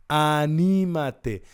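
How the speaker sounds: slow rallying call; emphatic exhortation. Real, not acted. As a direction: stretch each syllable and project it. Preacher exhorting the listeners.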